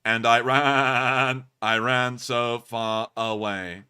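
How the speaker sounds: slow, stiff robot monotone. Shown as a demonstration. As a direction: read it like an actual robot. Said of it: "we really gotta read that like an actual, uh, robot".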